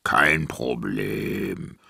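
very slow, deep giant voice; unbothered giant. A narrator's reading. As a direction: wave it away in a low, drawn-out rumble. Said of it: "Aber Simon sagte".

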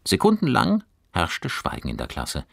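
ordinary delivery

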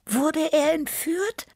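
slow, very high little voice; small cat Tippi. A narrator's reading. Small cat guesses the drummer was kidnapped, high-voiced wondering. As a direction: ask it in a tiny high cat voice.

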